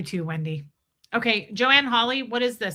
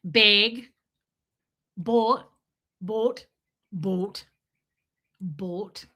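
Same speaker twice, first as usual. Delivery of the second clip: put-on Minnesotan accent, BAYG and long round boat; Debbie Zito; much slower than usual. Host imitating her friend, Minnesotan accent. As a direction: Minnesotan vowels, each word set apart. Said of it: "Debbie Zito has the most deep".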